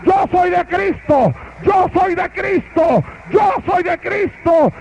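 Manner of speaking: high, slow full-voiced shout; preacher leading the congregation in a shout. Real, not acted. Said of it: "Grítelo".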